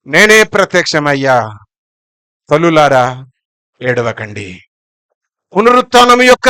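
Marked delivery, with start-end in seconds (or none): 0.1-4.6 low, hushed comfort, falling softer; gentle consoling. Acted. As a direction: console the weeping women quietly. Risen Jesus comforting the weeping women.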